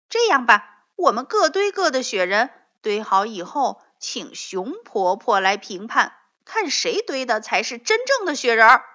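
loud, fast excited proposal; eager suggestion; eager idea. A narrator's reading. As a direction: burst out with a plan. Proposing a contest.

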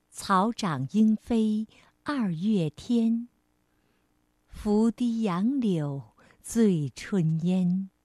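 slow, measured classical verse; poem recitation. Shown as a demonstration. Teacher reads an old poem to children, slow measured recitation. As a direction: recite with drawn-out line endings and pauses.

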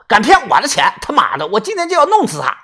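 high, fast furious swearing; mild acted fury of a cheated man; angry cursing. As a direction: rage that you'll kill her. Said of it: "骂道".

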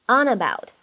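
"on about" run together as "o-nabout"; linking. Shown as a demonstration. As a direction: carry the n of "on" into "about". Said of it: "我们会有一个连读".

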